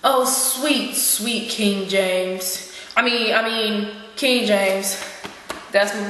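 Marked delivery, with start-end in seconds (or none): 0.0-2.7 slow, drawn-out swoon over a beloved; dreamy, lovestruck gushing; adoring sigh. Acted. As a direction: linger lovingly on each word. Gushing over his rumored lover.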